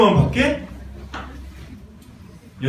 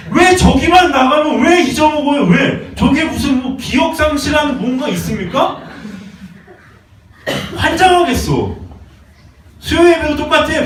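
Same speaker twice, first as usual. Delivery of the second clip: exasperated outburst; 7 semitones higher than usual. Real, not acted. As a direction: vent frustration at the forgetful flock. Pastor exasperated at forgetful flock.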